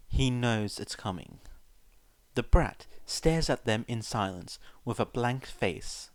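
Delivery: usual speaking voice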